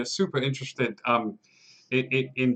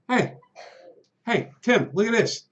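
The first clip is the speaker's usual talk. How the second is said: higher, sly guard showing off; guard's voice; 7 semitones higher than usual. Acted. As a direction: brag to your buddy in a higher voice. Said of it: "he looks at it and goes".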